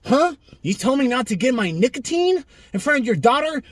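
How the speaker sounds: high, dopey mock voice of the vaper; mocking dumb vaper. Vlogger mocks the vaper who stabbed a dad, high dopey voice. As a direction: play the vaper as a clueless idiot. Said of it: "The dumb loser vapor".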